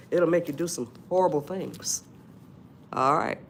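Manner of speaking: ordinary talk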